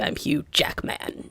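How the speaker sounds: slow, very soft "Hugh Jackman" in his way; hushed copy of how he says "Jackman"; imitated name pronunciation. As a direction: mimic his "Jackman" under your breath. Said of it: "I wonder if he says it that way".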